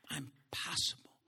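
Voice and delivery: near-whispered, softly voiced affirmation; hushed declaration. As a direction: whisper it as a private conviction. Quiet declaration to the Lord.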